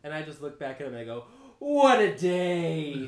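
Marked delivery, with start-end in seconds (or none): 1.6-3.1 much louder, drawn-out cheer; loud slow exclamation. Acted. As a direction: boom it out slowly to a stranger. Player character greets NPC loudly.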